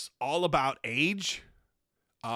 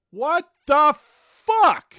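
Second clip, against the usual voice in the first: much louder and higher, drawn out slowly; outraged shout; 9 semitones higher, 12 dB louder, much slower than usual. Real, not acted. Ranting at the dictionary, outraged slow shout. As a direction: explode in disbelief. Ranting about literally's redefinition.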